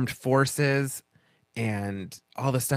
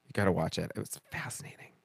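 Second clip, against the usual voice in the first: hushed, almost unvoiced; whispered fascination; 7 semitones lower, 8 dB quieter, much faster than usual. Real, not acted. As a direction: breathe it out, intrigued. Whispered aside about the show.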